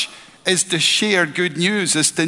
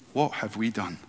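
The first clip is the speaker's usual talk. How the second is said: hushed dismay; 7 semitones lower, 9 dB quieter than usual. Retold or imitated. Retold panic during hymns.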